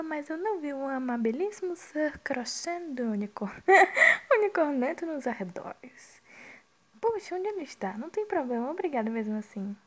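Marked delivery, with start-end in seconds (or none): 0.0-6.2 breaking up into laughter mid-line; can't hold back laughter at the silly name; laughing while reading. Real, not acted. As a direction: read through the giggles.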